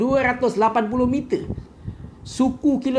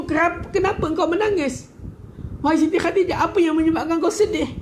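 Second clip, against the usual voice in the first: much higher, pleading why she weeps; pleading question; 8 semitones higher than usual. Retold or imitated. The Prophet asks Khadijah again, high and pleading. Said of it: "Habis itu".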